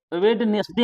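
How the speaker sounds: वेडनेसडे with the first d sounded; mistaken pronunciation. Retold or imitated. Teacher mimics how we misread it, वेडनेसडे with audible d. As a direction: read वेडनेसडे as spelt, "wed-nes-de". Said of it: "हम लोग पढ़ लेते हैं".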